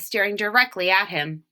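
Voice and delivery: ordinary delivery, nothing marked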